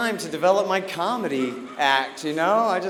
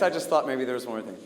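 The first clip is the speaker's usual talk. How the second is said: usual speaking voice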